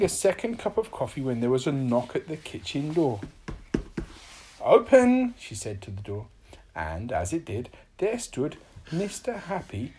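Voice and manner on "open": high, loud order; magical command. A narrator's reading. Little Miss Magic orders the door open, high loud command. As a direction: call out the spell word.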